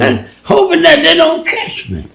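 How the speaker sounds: laughing at his own terror from back then; excited retelling. Real, not acted. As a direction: let the voice jump up high as you relive the scare. Recalling his fear in the South.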